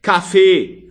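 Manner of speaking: loud, firm call. A narrator's reading. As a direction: call for coffee briskly. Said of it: "rief Karl".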